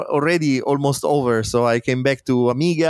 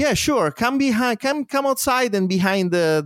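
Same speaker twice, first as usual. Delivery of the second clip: mock friendly; 8 semitones higher than usual. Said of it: "So they were like".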